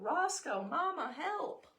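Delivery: high, slow pleading puppy cry; dog calling for help. Acted. Lost dog calling for his brother and mom, high and pleading. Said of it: "running on the tracks saying".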